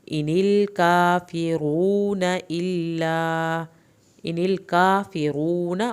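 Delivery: الکافرون with the long u drawn out; slow recitation, long vowel held; stretched long u. Shown as a demonstration. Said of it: "واو ساکن کو کھینچیں".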